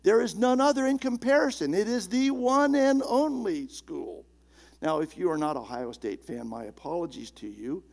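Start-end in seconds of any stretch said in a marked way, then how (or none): 0.0-4.2 higher, louder, fast proud boast; a Buckeyes fan bragging about his school, puffed up and proud; boastful fan. Retold or imitated. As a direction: crow it like a die-hard fan. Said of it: "it sounds like you're saying".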